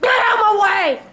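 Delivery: high, loud burst of impatience; shouting. Real, not acted. Player yelling at something blocking the way, urgent shout.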